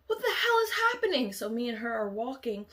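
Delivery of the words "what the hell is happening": very high, loud and fast with a crying edge; panicked cry. Retold or imitated. Narrator freaking out with her new friend, crying. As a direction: cry it out in helpless alarm.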